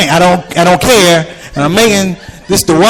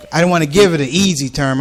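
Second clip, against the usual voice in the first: ordinary delivery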